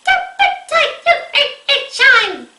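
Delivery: very high, loud cackling witch; witch cackle voice. Shown as a demonstration. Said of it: "the witch cackling sound".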